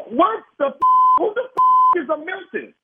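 high, loud furious yell; angry outburst. Real, not acted. Husband demanding who the caller is, loud high anger. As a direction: snap at him in outrage.

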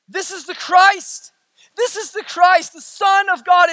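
high, ringing declaration; bold confession. Acted. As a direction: proclaim it with slow conviction. Acting out Peter's bold confession.

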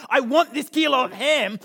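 loud, high, pleading demand; worked-up kid. Retold or imitated. Dad voicing his son begging loudly at Costco. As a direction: insist like a desperate boy. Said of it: "He was getting really worked up".

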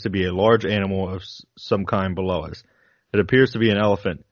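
steady, deliberate reading of a flight log; reading a diary aloud. Acted. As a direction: read it like a pilot's logbook entries. Reads the admiral's flight log.

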